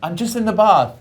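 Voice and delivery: high, loud English schoolboy reply; flipped English accent. Retold or imitated. Son answering his mother on the phone, high English accent. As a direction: switch into exaggerated English, calling back to mum. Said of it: "it would flip like that".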